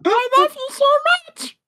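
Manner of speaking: put-on voice. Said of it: "I gotta, like, warm up".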